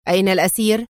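mildly stern demand. A narrator's reading. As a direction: question him with restrained severity. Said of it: "سألته في صرامة". (سؤال بصرامة)

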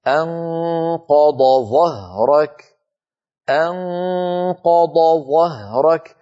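أنقض ظهرك chanted slowly, ض then a separate ظ; slow Quranic recitation of the correct form; dad and zha kept apart. Shown as a demonstration. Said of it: "فالبيان لازم".

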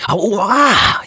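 high, loud yelp of pain; sharp ouch. Acted. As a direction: yelp as fingers touch the hot bulb. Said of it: "jęknął".

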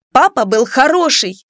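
high, indignant cry; stamping protest. A narrator's reading. Daughter defending her father to her mother, indignant protest. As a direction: insist like a girl stamping her foot. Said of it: "топнула ногой Клара".

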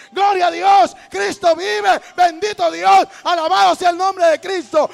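loud, high cries of worship; shouted church praise. Acted. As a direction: shout praises like a fervent congregation. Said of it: "gente que grita a los lados y dice". (alabanzas gritadas, a voz en cuello)

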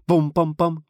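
loud, high banging noises; three hard hits done by voice; imitated impacts. Narrator imitates meteor impacts. (громкая имитация ударов)

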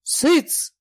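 high, sharp command to hush; roaring. A narrator's reading. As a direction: silence them with a short cry. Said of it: "взревел Фома". (резкий окрик)

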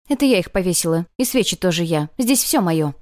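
loud, possessive insistence; barking. A narrator's reading. Girl claims the memorial as hers, barking. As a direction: lay angry claim to it all. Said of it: "рявкнула она".

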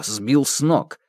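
ordinary delivery

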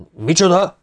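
high, incredulous demand; sharp rising "what?" from an officer; astonished outburst. A narrator's reading. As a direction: burst out in disbelief. Startled major demands an explanation.

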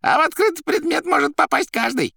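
very high, loud character voice; excitable Koroviev. A narrator's reading. Koroviev confirming his suspicions, high and excitable. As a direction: exclaim theatrically in a high voice.

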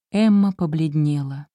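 ordinary delivery

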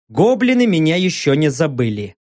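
ordinary delivery

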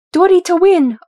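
high, loud excited cheer; blurted. A narrator's reading. Ori backing Dori in a fight, blurted loudly. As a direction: blurt it out like a fan. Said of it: "Ori immediately blurted".

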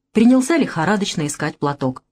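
ordinary delivery

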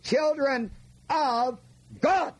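put-on "Children, of, God" word by word, "God" pitched highest; stress on God. Shown as a demonstration. Preacher showing the class where the emphasis falls, stress on "God". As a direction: set each word apart and punch "God" high. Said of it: "The emphasis is not on the children, the emphasis is on God".

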